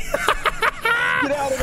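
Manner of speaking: high, slow, frantic shout; panicking gamer. Real, not acted. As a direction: scream a warning in terror. Monster chasing, shouting warning.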